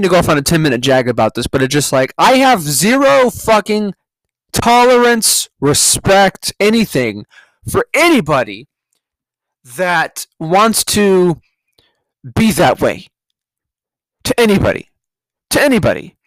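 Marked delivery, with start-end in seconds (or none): 2.2-8.7 slow, emphatic, exasperated rant; pissed off. Real, not acted. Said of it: "It just pisses me off". 9.8-16.1 pissed off. Real, not acted. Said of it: "It just pisses me off".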